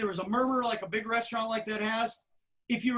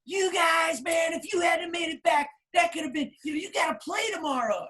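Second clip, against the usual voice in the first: scolding yell; 7 semitones higher than usual. Retold or imitated. Manager yelling at the band for coming back late, scolding. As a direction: chew them out. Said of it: "he was yelling".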